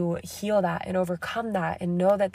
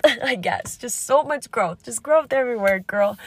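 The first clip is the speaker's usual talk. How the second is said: higher, louder giggly enthusiasm; playful laughing hype; 4 semitones higher, 5 dB louder than usual. Real, not acted. Host hyping up her listeners about growth, laughing and louder. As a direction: gush about it with a laugh, hamming it up.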